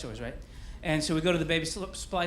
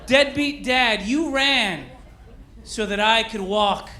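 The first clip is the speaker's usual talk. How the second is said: raised, drawn-out salute to an unsung hero; mock-solemn tribute; 5 semitones higher, 3 dB louder, slower than usual. Comedian honours deadbeat dads, in a grand mock tribute. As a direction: proclaim it like a grand dedication.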